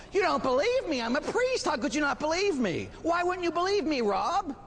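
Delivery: high-pitched, affronted protest; hammed-up offended priest; mock indignation. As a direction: protest as if outraged at being doubted. Acted outrage at being doubted.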